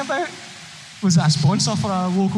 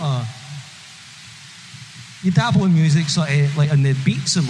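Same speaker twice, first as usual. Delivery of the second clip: usual speaking voice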